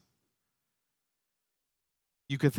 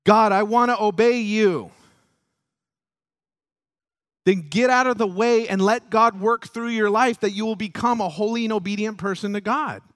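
loud, high, driving sermon delivery; impassioned preaching; 8 semitones higher, 7 dB louder than usual. Acted. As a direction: preach it with force and rising conviction. Preaches at full force.

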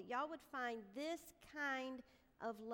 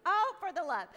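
loud, high cry of exasperation; retold fed-up holler, pitched up; exasperated outburst; 6 semitones higher, 13 dB louder than usual. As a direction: burst out at the end of your rope. Retold outburst at end of rope.

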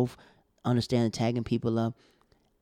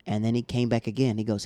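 normal talking voice

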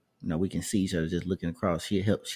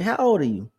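lightly voiced woman, pitched higher; imitating the older woman; 10 semitones higher, 4 dB louder than usual. Older woman coworker asks the young man his age, higher voice. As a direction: ask it in her higher voice, curious.

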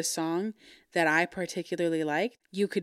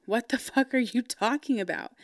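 higher-pitched, baffled comeback; exasperated retort; 5 semitones higher than usual. Retold or imitated. Singer baffled by talk of emphasis, exasperated. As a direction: retell it fed up, pitched up but not shouted. Retold confusion over emphasis correction.

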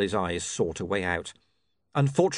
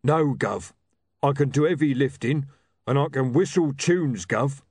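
higher, louder simple bruiser; eager ex-boxer; 5 semitones higher, 4 dB louder than usual. Acted. Ex-boxer offering his skills to William, eager bruiser voice. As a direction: list your talents proudly and simply. Rocky's figure voice.

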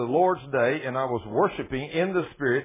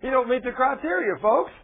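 laughing; 12 semitones higher, 6 dB louder than usual. Real, not acted. Preacher mocks impostor, laughing.

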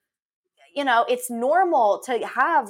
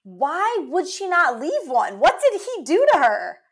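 incredulous; 7 semitones higher than usual. Real, not acted. Co-host baffled that she left no message, incredulous. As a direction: demand an answer, pitch swooping up.

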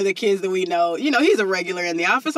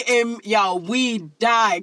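ordinary talk

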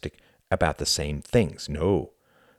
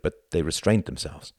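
ordinary delivery

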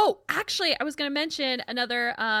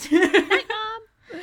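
calling out; 10 semitones higher, 8 dB louder than usual. Retold or imitated. Repeating the call to Mom.

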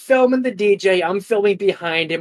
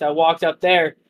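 ordinary talk

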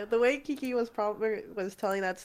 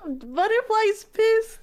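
high, drawn-out amazed exclamation; slow squeal of disbelief; 13 semitones higher, 5 dB louder, much slower than usual. Real, not acted. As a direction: stretch it out high and loud. Echoing the astonishing fact.

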